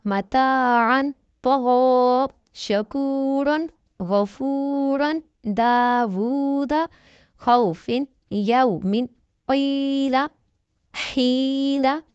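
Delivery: slow, measured tajweed reading of whole words; slow model recitation. Shown as a demonstration. Teacher reads the lesson words aloud once for students, slowly. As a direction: read each word slowly with correct lengthening. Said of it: "एक बार हवा में पढ़कर सुना देती हूँ".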